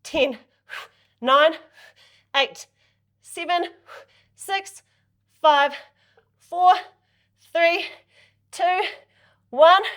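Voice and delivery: rising, punchy countdown; loud coach count. Shown as a demonstration. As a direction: call out each number. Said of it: "coach counting down reps".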